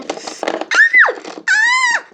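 high-pitched startled scream. Pretend. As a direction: shriek as the necklace is snatched. Sister grabs the necklace.

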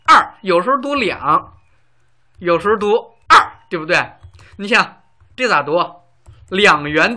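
loud, animated explaining; lively teacher pressing the point. Real, not acted. Teacher marvelling at 二 versus 两 to students, loud animated explanation. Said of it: "lively explanation of 二 and 两".

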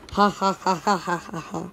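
staccato pretend cackle, tailing off; a mischievous, put-on ha-ha laugh; mock scheming laugh. Character plotting to trap people, mock scheming laugh.

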